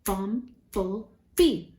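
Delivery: "Bumblebee" slowly split into three separate beats; syllable by syllable. Shown as a demonstration. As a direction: clap out "Bumblebee", a pause between syllables. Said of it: "How many syllables?".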